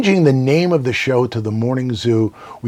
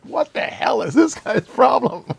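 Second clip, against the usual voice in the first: higher, mocking puzzled voice; higher mock what-is-wrong-with-him voice; incredulous toll guy; 12 semitones higher than usual. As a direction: play the baffled toll collector. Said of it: "this guy's looking at me like".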